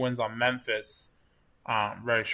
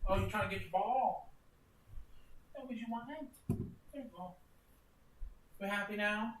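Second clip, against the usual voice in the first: chatting to the puppy dog in high doggy talk; 8 semitones higher, 8 dB quieter than usual. Real, not acted. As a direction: coo at the dog in a raised pitch. Talking to the puppy.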